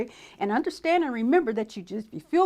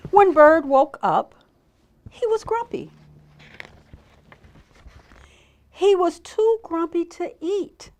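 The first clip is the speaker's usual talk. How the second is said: drawn-out, emphatic storybook reading; story narration for children; 6 semitones higher, 6 dB louder, slower than usual. A narrator's reading. Storyteller tells children about grumpy Bird, slow story narration. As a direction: read it slowly and clearly to little children. Reads picture book to children.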